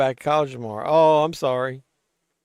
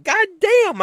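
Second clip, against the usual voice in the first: mock exclamation; 5 dB louder than usual. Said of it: "the movie was like".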